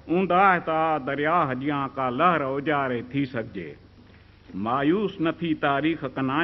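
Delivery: measured, lilting verse delivery; ghazal recitation. Acted. Poet opens his reading with a ghazal, lilting recitation. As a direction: recite each line to the audience, repeating it.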